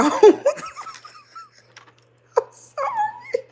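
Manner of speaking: high squealed laugh then apology; real laughter. Real, not acted. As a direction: apologize while still giggling.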